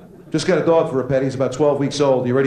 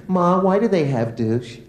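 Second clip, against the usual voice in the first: daughter's voice; 6 semitones higher, slower than usual. Acted. Daughter on the beach asking.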